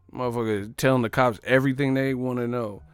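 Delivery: usual speaking voice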